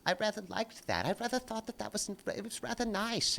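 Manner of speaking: put-on low, soft, prim English old-man voice; a man mocking Richard Dawkins missing Christmas carols, soft and prim; prissy impression. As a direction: mimic a fussy old scholar murmuring fondly. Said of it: "the one who talks like this".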